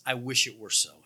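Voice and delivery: low, deflating aside; dry wish. Acted. Preacher quotes Paul after the taunts, dry and low. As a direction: drop down into a wry wish.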